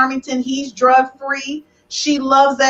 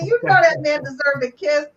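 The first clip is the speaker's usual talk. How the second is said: ordinary delivery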